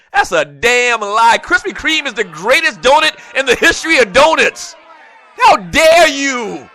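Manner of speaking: loud, high, slowed shouting; mock fury. Host shouting down Dunkin' fans over donuts. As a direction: yell it like a betrayed fan. Comic outrage rant.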